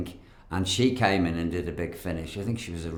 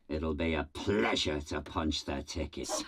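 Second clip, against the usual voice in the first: lightly voiced gloating villain; villain line; 3 semitones lower, 5 dB quieter than usual. Villain threatening the heroes, mildly gloating. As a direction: relish the threat a little.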